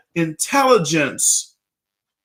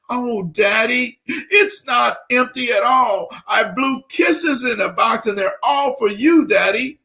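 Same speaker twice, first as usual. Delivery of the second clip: put-on tearful little girl; 7 semitones higher than usual. Daughter tearfully telling her father about the kisses, mildly tearful and higher. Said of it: "she started crying".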